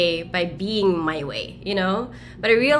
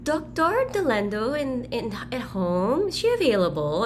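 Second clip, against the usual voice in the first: a higher, polite caller voice; hospital caller; 5 semitones higher than usual. Retold or imitated. A hesitant caller on the phone asking for the doctor, higher pitched. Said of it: "someone from the hospital".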